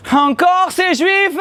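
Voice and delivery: very high, drawn-out mock complaint; mock exasperated outcry. Refused passenger grumbling at the bus, mock outraged cry. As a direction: wail it slowly in put-on indignation.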